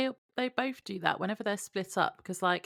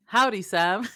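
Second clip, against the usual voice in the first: louder mock cowboy greeting in a Western accent; 6 dB louder, slower than usual. Co-host quoting the demon's cowboy western act, Western drawl. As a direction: lightly imitate a Western cowboy's howdy. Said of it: "cowboy western horror to go along".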